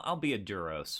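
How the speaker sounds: ordinary delivery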